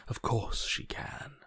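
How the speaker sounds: slow hushed whisper. Acted.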